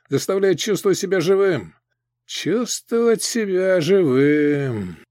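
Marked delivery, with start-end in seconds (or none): 2.3-5.0 slow, sad echo; sad repetition. Acted. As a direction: repeat it slowly and sadly. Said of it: "печально повторил гоблин".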